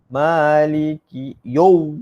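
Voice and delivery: slow, loud "yau" rounded to yo; common misreading. Shown as a demonstration. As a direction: chant "Maliki yau-" slowly with o for au. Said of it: "selalulah salah sebut".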